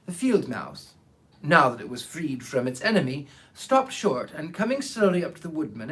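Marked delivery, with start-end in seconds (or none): none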